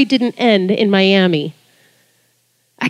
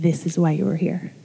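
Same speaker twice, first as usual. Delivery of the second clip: hushed voice of God; 6 semitones lower, 9 dB quieter than usual. Retold or imitated. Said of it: "And he said".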